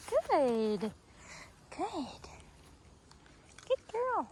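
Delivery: very high praise; puppy praise. Real, not acted. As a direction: squeak out the praise.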